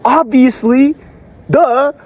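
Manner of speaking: high, sarcastic mock of the quitter. Mocking the viewer who just gave up, sarcastic high. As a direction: sneer it out like a know-it-all.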